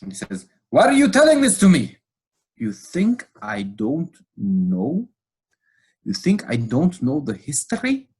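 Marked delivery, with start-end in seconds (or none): 0.7-1.8 furious shout, far higher and louder; an indignant outburst in a retold scene; really angry. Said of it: "the pastor gets really angry". 2.6-5.1 angry, drawn out. Retold or imitated. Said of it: "the pastor gets really angry". 6.0-8.1 the retort repeated, more heated; angry, pressing. Retold or imitated. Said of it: "the pastor gets really angry".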